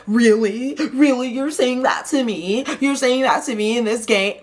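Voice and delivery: high, incredulous retort, acted out; mock offended disbelief. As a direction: imitate her indignant comeback. Acts out contestant's offended comeback.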